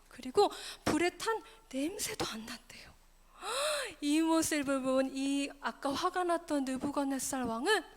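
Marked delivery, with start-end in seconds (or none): 3.4-4.0 sharp audible intake of breath; storyteller's gasp. Pretend. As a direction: gasp for the children. King sees the miracle. (헉 하고 숨 들이쉬기)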